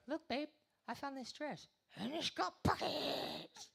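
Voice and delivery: put-on high, gushing girlfriend voice, slow at the end; hammed-up excited girlfriend; mock girlfriend. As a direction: gush like a girlfriend showing off a dress. Girlfriend voiced in stand-up bit.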